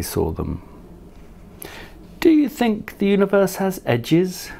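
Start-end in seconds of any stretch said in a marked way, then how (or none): 2.2-4.5 high, light duck voice asking; a lightly voiced duck wondering aloud; quacking duck voice. Marianne the duck asks Leonard under the stars, high light voice. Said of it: "quacked Marianne".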